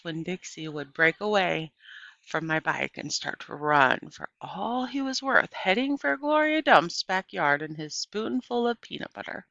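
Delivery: ordinary delivery, nothing marked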